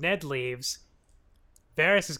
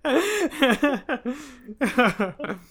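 laughing through it; 9 semitones higher, 7 dB louder than usual. Real, not acted. Joke said while laughing.

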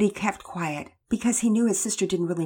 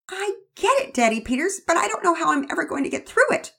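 put-on higher girl's voice, worried; anxious young girl; 12 semitones higher, 6 dB louder than usual. Girl to her father, higher and worried. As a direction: tell dad you can't cope.

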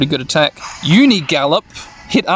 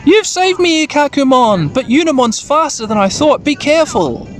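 high, urgent character voice; excited rescued boy; 9 semitones higher than usual. Acted. Juo warns Ikakumon about Unimon, high and urgent. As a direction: thank and warn, pitched way up.

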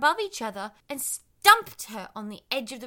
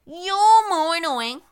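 put-on whiny pumpkin boy; 5 semitones higher, 11 dB louder, slower than usual. Pumpkin retorts.

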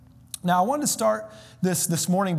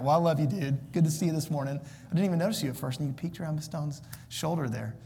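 normal talking voice

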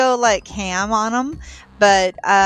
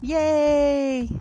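cheer; 6 semitones higher, 4 dB quieter than usual. Real, not acted. Happy about gift.

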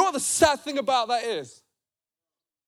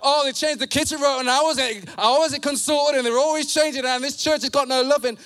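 emphatic, raised pulpit voice; punchy, impassioned sermon delivery; forceful preaching; 3 semitones higher than usual. Real, not acted. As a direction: press each point hard on the congregation.

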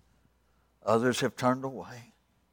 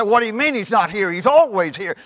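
high, loud incredulous protest; acted loud objection; loud disbelief; 9 semitones higher, 11 dB louder than usual. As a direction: argue back at the crowd, voice raised. Daddy argues with the crowd.

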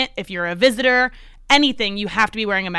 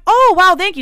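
flattered surprise; 6 semitones higher, 6 dB louder than usual. Retold or imitated. Retold reply to a stranger's compliment.

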